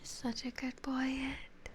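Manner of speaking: high soft cooing for a pet; whispered pet talk. Real, not acted. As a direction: whisper praise to the dog.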